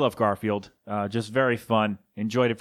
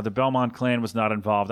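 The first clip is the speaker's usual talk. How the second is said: ordinary delivery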